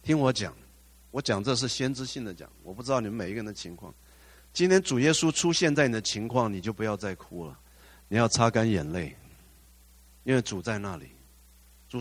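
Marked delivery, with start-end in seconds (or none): 4.5-11.1 loud, driving sermon cry to the congregation; preaching at full force. Acted. Pastor urging grieving believers to stop crying, preaching loudly. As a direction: declare it like a prophet from the pulpit.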